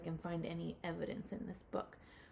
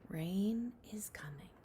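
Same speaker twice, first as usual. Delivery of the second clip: a breathy whisper to build suspense; whispered story line; slower than usual. Acted. Teacher to class reading, whispering that rain is coming.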